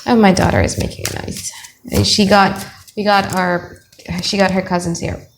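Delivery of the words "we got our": usual speaking voice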